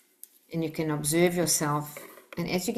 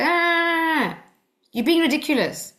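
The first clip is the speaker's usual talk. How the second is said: mocking dismissal; 9 semitones higher than usual. Shown as a demonstration. Teacher acts a dismissive parent.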